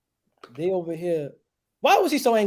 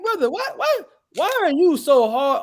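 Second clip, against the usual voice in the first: whiny mock follower; 6 semitones higher, 4 dB louder than usual. Mocking a whining follower.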